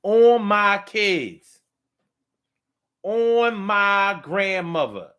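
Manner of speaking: drawn-out, high swearing; mock emphatic oath. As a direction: stretch each oath out loudly. Acts out a liar swearing oaths.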